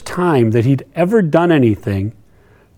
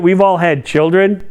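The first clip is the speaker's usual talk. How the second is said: ordinary talk, nothing marked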